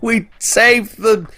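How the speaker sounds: high, excited cartoon cheer; triumphant cartoon sponge shout; SpongeBob quote. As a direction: squeal it like SpongeBob. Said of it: "quote SpongeBob with".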